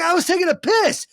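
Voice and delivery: very high, loud holler; indignant yell, re-enacted; yelled protest. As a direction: shout back up in protest. Acted shouted protest.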